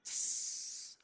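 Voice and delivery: usual speaking voice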